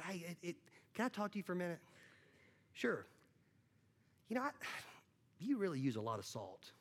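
soft, hesitant, confiding tone; awkward hushed critique. Pretend. Dinner guest quietly criticises the host's cooking, hushed and hesitant. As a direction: lean in and whisper the complaint.

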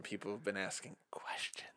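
hushed secretive whisper. Acted. Conspiratorial hush about questions.

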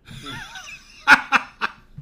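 loud, high bursting laugh; real laugh breaking out, loud and high; big burst of laughter. Real, not acted. Teller cracking up at the butter dish of ice, loud laugh.

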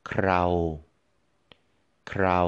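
เครา on a level flat tone. Shown as a demonstration. Teacher modelling เครา for learners, flat tone. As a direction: hold เครา steady. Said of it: "flat tone".

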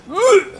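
very high gag; mock gag. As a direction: retch in falsetto. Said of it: "No le hagas el". (arcada muy aguda)

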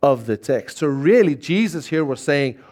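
ordinary delivery

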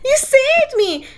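high, drawn-out damsel gratitude; mock swooning heroine. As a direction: gush like a rescued damsel. Repeats mocked swooning gratitude.

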